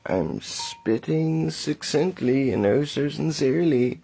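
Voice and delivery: loud, high town crier proclamation; grand rich voice. Acted. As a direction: announce it like a herald. Proclamation over music.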